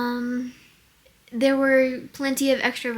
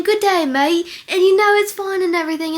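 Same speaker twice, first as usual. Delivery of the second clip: higher, louder mock Australian accent; 6 semitones higher, 6 dB louder, faster than usual. Shown as a demonstration. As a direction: put on a bouncy Aussie accent. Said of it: "I'll be doing one accent".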